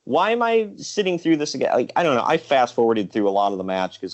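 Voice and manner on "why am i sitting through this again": high, fed-up complaining; exasperated. Retold or imitated. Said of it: "I'm like".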